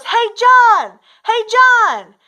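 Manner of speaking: loud, high yell from a car window; called-out yell. Retold or imitated. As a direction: shout a name across the work site. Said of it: "he yells out".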